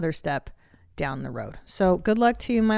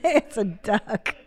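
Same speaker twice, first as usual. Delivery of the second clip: ordinary talk, nothing marked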